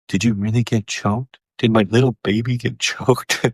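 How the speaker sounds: mock-anxious motherly cooing, laugh creeping in; concerned mom. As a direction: imitate a mother worrying over her baby. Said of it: "my mom is concerned".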